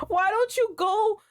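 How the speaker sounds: high, slow exasperated scolding; you keep doing it wrong, high and drawn out. Real, not acted. Scolding the game character.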